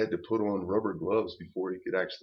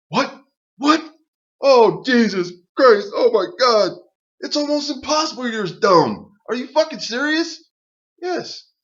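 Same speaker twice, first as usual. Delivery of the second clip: high, loud disbelieving yell; shocked outrage; 13 semitones higher, 10 dB louder than usual. Retold or imitated. To the dumb woman, a high, loud, shocked yell. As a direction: shriek it in total disbelief at her.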